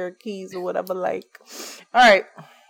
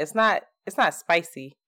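ordinary delivery

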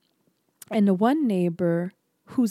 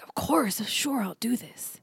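half-whispered acted reply; hushed, quick assent of a friend; hushed agreement; 6 dB quieter than usual. Guy agreeing to a dying friend's request, hushed.

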